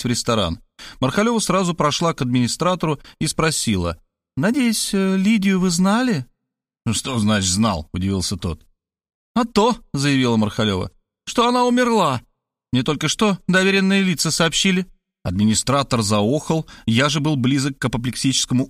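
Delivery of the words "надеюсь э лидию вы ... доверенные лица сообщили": higher, bluntly cheerful woman; higher woman's voice, tactless and chirpy; brash Markhaleva. A narrator's reading. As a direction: drop the bad news breezily.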